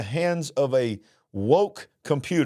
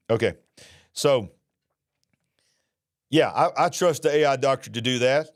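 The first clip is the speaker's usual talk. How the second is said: normal talking voice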